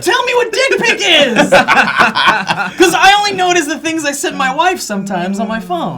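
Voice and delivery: high laughing question. Real, not acted. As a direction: crack up while asking. Joking, laughing.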